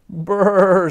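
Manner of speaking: shivering. A narrator's reading. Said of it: "said Wanda".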